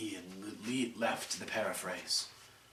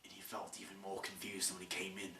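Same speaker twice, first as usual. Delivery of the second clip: whisper; 4 semitones lower, 13 dB quieter, faster than usual. A narrator's reading. Narrator whispers.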